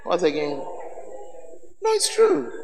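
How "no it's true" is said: ordinary talk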